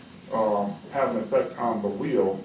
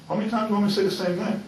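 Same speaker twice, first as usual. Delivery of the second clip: fast, higher-pitched exasperation; exasperated; 8 semitones higher, much faster than usual. Real, not acted. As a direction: fire it off, fed up with repeating yourself. Irritated at repeating his answer.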